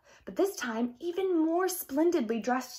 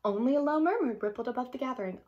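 usual speaking voice

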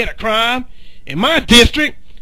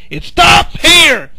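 shouting. Acted. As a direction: drive every word home to the troops. Sheriff's rallying speech to deputies.

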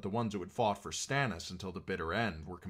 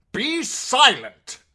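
very loud, drawn-out command; bellowed order; 17 dB louder, much slower than usual. A narrator's reading. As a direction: bellow to silence the man. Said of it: "Sir Kevan Lannister bellowed".

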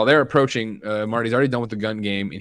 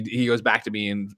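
ordinary talk

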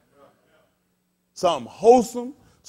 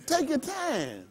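soft, slow, higher-pitched; hushed advice; 6 semitones higher, 8 dB quieter, much slower than usual. Real, not acted. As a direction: stretch it out as a gentle caution. Urging listeners to slow down.

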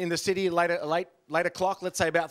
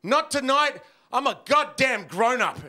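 higher and louder, slow and proud; mock heroic resolve; 5 semitones higher, 4 dB louder, slower than usual. Comic to the crowd, announcing his mock heroic refusal. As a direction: declare it like a grown-up hero. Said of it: "I said".

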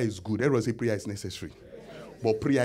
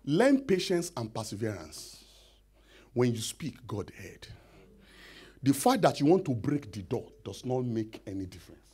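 ordinary talk

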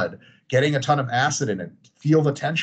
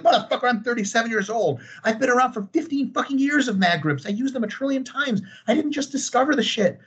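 higher, fed-up comeback; exasperated rant; 9 semitones higher than usual. Retold or imitated. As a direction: vent like you've heard it a thousand times. Exasperated reply to commenter.

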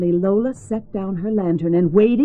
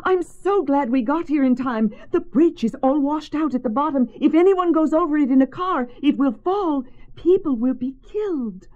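higher, breathless rush of news; urgent warning; 7 semitones higher than usual. A narrator's reading. Lola warning the congregation, urgent blurting. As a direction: blurt out the danger.

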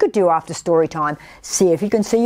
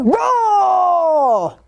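high, long falling play roar; little tiger roar; 11 semitones higher than usual. A narrator's reading. Little tiger springing out to scare, high roar. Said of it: "I know you can roar".

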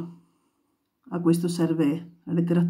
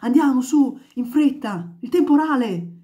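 loud, high, hurried calls; urgent shouting; 6 semitones higher, 5 dB louder than usual. Acted. Neighbours shouting to hurry home before the storm. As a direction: rush everyone inside.

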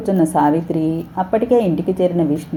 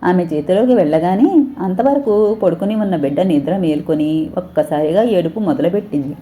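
ordinary delivery

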